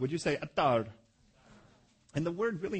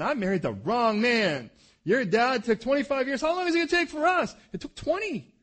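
loud, higher, exasperated mock wife; frustrated wife; 9 semitones higher, 10 dB louder than usual. Preacher voicing Rebekah's frustration at Isaac, loud exasperated complaint. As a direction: shout it like a fed-up wife, rising. Wife's frustration voiced in a story.

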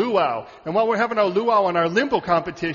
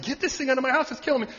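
high-pitched mock plea for help; put-on urgent complaint, pitched up; panicked homeowner; 7 semitones higher, faster than usual. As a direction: imitate a frantic caller wanting it gone. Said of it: "and we said".

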